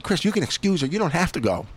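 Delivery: a lightly voiced tempter in his head; tempting inner voice. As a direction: play the voice that lets you off the hook. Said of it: "One side of me is saying".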